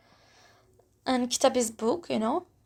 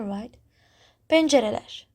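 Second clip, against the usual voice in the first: ordinary delivery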